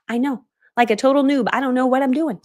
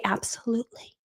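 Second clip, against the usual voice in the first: low-key absolutely; 7 dB quieter than usual. Retold or imitated. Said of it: "Act like this is normal".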